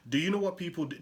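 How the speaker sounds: usual speaking voice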